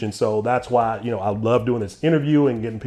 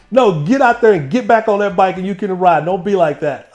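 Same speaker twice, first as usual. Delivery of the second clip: higher, louder firm father; stern dad; 7 semitones higher, 6 dB louder than usual. Retold or imitated. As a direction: send the crying boy back out. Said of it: "It's like".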